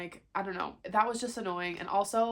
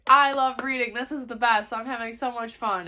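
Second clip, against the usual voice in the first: higher and louder, giddy gushing; mock gushing; 4 semitones higher, 9 dB louder than usual. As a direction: play up her past self's giddy delight. Retold gushing past self.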